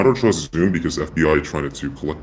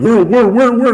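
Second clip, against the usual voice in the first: impatient; 7 dB louder than usual. Retold or imitated. Impatient self-quote, searching.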